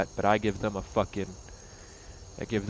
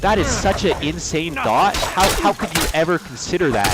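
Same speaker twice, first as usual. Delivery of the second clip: much higher, louder mock amazement; sarcastic; 7 semitones higher, 7 dB louder than usual. Mocking the victim's logic, sarcastic mock wonder. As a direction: gush with fake astonishment.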